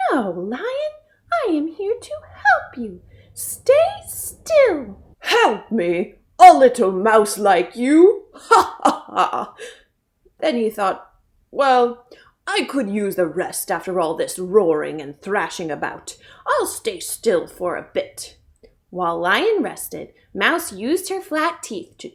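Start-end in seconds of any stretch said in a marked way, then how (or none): 0.1-5.0 high mouse, rising to a firm "Stay still"; tiny mouse taking charge. A narrator's reading. Mouse telling lion to stay still, high. 5.2-9.5 loud doubtful lion, then mock "ha, ha, ha"; hammed-up scoffing lion; amused lion. A narrator's reading. Lion laughing at the little mouse, hammed up. 11.5-18.4 lower, lightly voiced lion; tired lion deciding to rest. A narrator's reading. Lion thinking to himself, lower.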